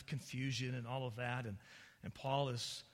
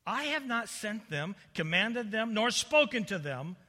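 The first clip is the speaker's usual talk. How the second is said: loud, raised divine rebuke; declaimed scripture; 8 semitones higher, 10 dB louder, slower than usual. Acted. God disowns the lying prophets, loudly declaimed. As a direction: proclaim each denial forcefully.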